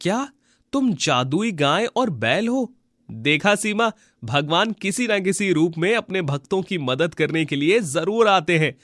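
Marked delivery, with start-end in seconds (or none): 0.0-2.7 astonished question. Acted. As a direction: ask the talking animals in raised disbelief. Gopal astonished at talking animals.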